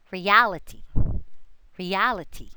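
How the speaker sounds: "Reality" as re-AL-i-ty, AL strongest; stress before -ity. Shown as a demonstration. Teacher models the suffix rule, "Reality" stressed just ahead of -ity. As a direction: punch AL in "Reality", light -ity ending. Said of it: "the accent falls".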